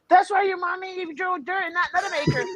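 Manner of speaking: put-on squeaky movie-girl quote; Joe Dirt girl impression. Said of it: "he sounds like that fucking girl from Joe Dirt".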